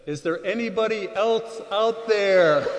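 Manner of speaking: high, loud, drawn-out cry; punchline shout. Retold or imitated. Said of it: "And he said".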